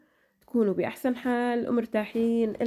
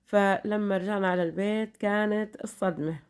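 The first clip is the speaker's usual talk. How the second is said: ordinary talk, nothing marked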